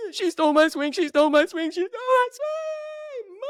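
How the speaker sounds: put-on high, loud kid's wail of complaint; whiny child tattling. Dad voicing his kid running up to complain. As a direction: wail it like a wronged little kid.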